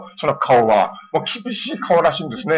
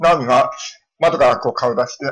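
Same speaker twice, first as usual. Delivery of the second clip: ordinary talk, nothing marked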